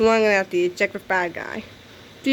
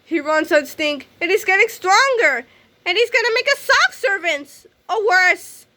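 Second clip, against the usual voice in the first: put-on excited kid; 10 semitones higher, 4 dB louder than usual. Kid warns of growing danger.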